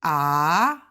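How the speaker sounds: AH rising from low to high; second tone. Shown as a demonstration. Teacher explains second tone to learners, AH rising low to high. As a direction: start AH low and glide upward. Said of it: "second tone goes from low to high".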